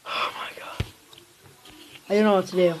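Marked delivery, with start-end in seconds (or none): 0.1-0.9 whispered. Real, not acted. Bad pack.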